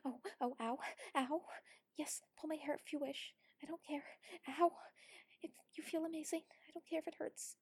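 loud, breathy yelps; pained insistence. Acted. Urging a partner on, pained and loud.

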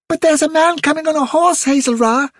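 very high little rabbit voice; tiny, high-pitched youngster; young rabbit. A narrator's reading. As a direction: pipe up like a worried bunny child. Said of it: "said one of the young rabbits".

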